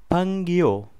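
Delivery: फंगियो said as a Chinese word, pitched high; Chinese word demo. Shown as a demonstration. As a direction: model फंगियो for the class. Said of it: "जो फंगियो लफ्ज़ होता है ये Chinese में". (फंगियो Chinese उच्चारण में)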